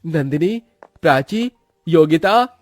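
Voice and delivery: high, lilting call, each name rising. Acted. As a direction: summon the sisters one by one. Brother calls his sisters.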